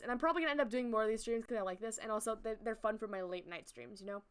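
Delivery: ordinary delivery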